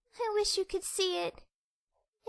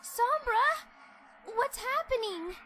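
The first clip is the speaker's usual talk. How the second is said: high, frightened call; alarmed; 3 semitones higher, slower than usual. Acted. Friend watching Sombra start to vanish, alarmed. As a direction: cry out to him in alarm.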